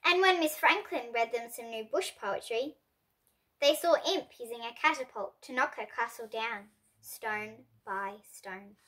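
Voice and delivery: usual speaking voice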